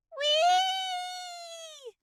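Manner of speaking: very high soft whee; thrilled whee. Acted. Acorn carried up a tree, thrilled. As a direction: let it fly up like a ride. Bounding branch to branch.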